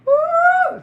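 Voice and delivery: very high loud ooh; big ooh. Real, not acted. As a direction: let out a huge outraged ooh. Outraged punchline.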